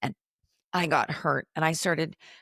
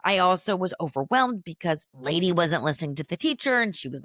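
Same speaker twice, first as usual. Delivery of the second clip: ordinary talk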